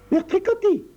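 put-on high little girl's voice, impatient and sharp. Tricotta interrompt sa mère avec impatience, voix aiguë. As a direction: snap at her fussing mother. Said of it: "dit Tricotta avec impatience".

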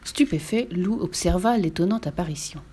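plain reading voice

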